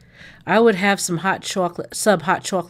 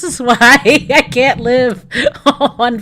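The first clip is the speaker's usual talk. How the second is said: laughing; 3 semitones higher, 7 dB louder, slower than usual. Real, not acted. Laughs at own Pinterest habit.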